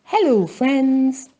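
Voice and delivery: lightly voiced kitten calling hello; cheerful cat greeting. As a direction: greet your friends like a happy kitten. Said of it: "Kathy Cat says".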